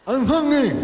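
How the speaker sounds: tiny high [name] voice; [name] impression. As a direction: squeak it out like a childlike [name]. [name] repeats.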